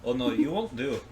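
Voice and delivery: Scottish accent. Real, not acted. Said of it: "You went Scottish there for a second".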